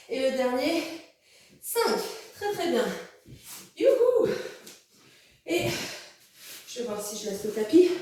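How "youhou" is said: excited cheer. Real, not acted. Cheering as the set ends.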